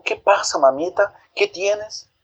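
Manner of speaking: put-on high, anxious child voice; a frightened boy runs to his mother; high worried child asking; worried little boy. A narrator's reading. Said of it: "José corrió y le dijo".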